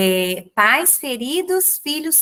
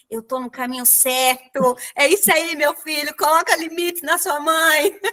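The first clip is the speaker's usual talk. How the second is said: inner joy; 3 semitones higher than usual. Retold or imitated. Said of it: "eu fiquei assim, toda feliz dentro de mim".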